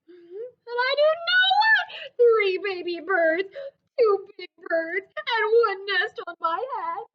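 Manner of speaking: high, loud, whining refusal; frustrated Gerald. Acted. Gerald refuses the birds on his head, loud and whiny. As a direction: Gerald stamping his foot in words.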